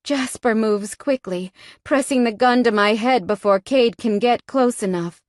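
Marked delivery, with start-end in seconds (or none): none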